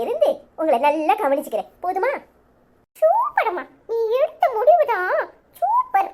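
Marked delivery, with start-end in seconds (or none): 3.0-6.1 put-on much higher childish voice, cheeky and gleeful. Child gleefully telling mother how grandma panics, high kid voice. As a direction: play a little kid gloating over grandma.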